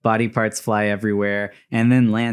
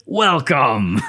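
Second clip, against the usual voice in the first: scorpion voice; 4 semitones higher, 4 dB louder than usual. Retold or imitated. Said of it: "And he says".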